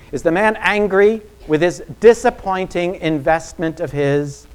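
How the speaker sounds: loud, rising, indignant preaching; preaching at full force. Acted. Preacher to congregation, painting an angry master in a loud preaching voice. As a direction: pound out the angry-boss picture from the pulpit.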